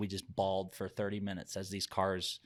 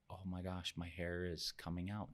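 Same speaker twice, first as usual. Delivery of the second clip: quiet inner thought; 3 semitones lower, 10 dB quieter than usual. Retold or imitated. Retelling shock at hair loss.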